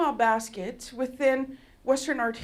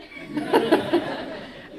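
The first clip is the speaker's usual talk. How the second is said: a high, breathy burst of laughter; 5 dB louder than usual. Real, not acted. Laughing at the tech's joke, high. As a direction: laugh out loud at the memory.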